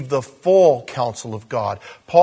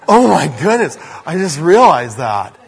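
mock astonishment; 7 dB louder than usual. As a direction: exclaim a pretend sudden revelation, laughing by the end. Mock sudden realization, joking about candidates.

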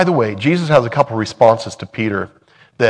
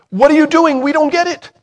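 high, loud baffled question; puzzled Peter; 6 dB louder than usual. Pretend. Preacher repeating what Peter should have asked, high and baffled. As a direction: ask it high and loud, bewildered.

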